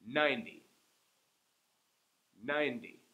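Ninety with the first syllable strong; stress on NINE. Shown as a demonstration. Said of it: "I'm saying each one twice".

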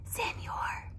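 hushed whisper to a sleeper. Acted. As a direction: whisper it so he stays asleep. Porcupines whisper to sleeping man.

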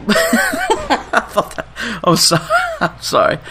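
giggling through the apology; laughing. Real, not acted. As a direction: laugh while saying sorry. Laughing apology mid-commentary.

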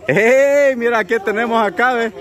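high, loud excited call. Real, not acted. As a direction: burst in with delight. Showing off the party cake.

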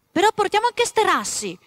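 put-on high, loud pleading boy; child's voice. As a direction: beg like a little boy. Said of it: "disse Pizzi".

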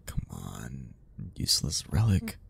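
low, hushed grumble of frustration; annoyed mutter. Acted. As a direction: grumble it at the machine, barely audible. Grumbling at the stalled elevator.

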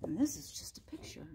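quick whispered aside; whispering. Real, not acted. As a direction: whisper softly.

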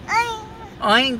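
high squealing piggy oink. Shown as a demonstration. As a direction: squeal it like a little pig. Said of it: "What does a pig say?".

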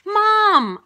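high, loud shout for mom; excited yell. A narrator's reading. Boy finding a stegosaurus, yelling to mom. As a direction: holler to your mother. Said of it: "I yelled".

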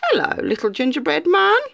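high, honeyed sly fox; sly fox greeting the gingerbread man with a grin; grinning fox. Acted. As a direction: charm the little cookie with a grin. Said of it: "he grinned".

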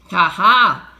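loud, gloating ha ha; triumphant scoff. A narrator's reading. Stanley Lambchop to himself about the thieves, loud scoffing.

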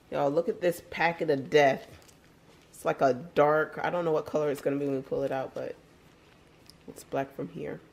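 ordinary talk

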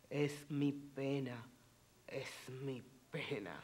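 dramatic reading of Spanish verse with long pauses; Spanish poem recitation. Acted. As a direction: declaim it like a proud lament.

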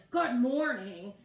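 slow, loud, menacing growl of a greeting; loud slow sinister good morning; roaring growl. Retold or imitated. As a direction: growl it like an evil spirit. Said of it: "she was roaring like".

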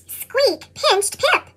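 plain reading voice

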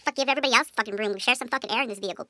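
normal talking voice

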